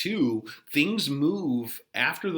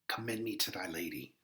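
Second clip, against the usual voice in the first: put-on softer, lower amused young Romeo; 4 semitones lower, 11 dB quieter, faster than usual. Romeo answering the nurse's riddle, lower and softer.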